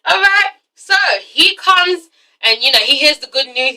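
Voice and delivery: ordinary talk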